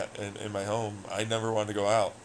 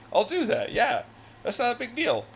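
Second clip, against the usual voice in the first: breezy adult voice; 13 semitones higher, 4 dB louder than usual. Retold or imitated. Voicing adult self, casual.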